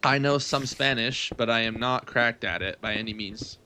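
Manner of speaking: usual speaking voice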